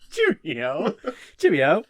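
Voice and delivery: trying out a British accent; tentative British greeting, rising at the end. Shown as a demonstration. Warming up for Mr Weasley, British accent attempt. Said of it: "Just give me a British accent".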